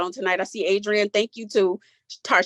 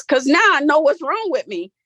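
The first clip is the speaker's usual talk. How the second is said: relieved; 5 semitones higher, 5 dB louder than usual. Real, not acted. Relief after borderline diagnosis.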